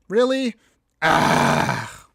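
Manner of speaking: loud exasperated cry; frustrated outburst. Real, not acted. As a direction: yell in frustration. Frustrated at losing game.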